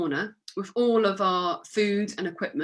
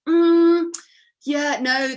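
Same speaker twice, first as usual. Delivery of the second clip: loud, high drawn-out hum of doubt; hammed-up hesitant correction; mock doubtful reply; 7 semitones higher, 9 dB louder, much slower than usual. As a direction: stall with a raised hum before gently saying no. Acted doubtful reply to wrong guess.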